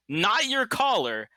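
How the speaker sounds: "not your collar" slowed, pitched high for emphasis; stress on COLLAR. Real, not acted. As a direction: draw out "not your collar" as a pointed correction. Game master corrects the contact point.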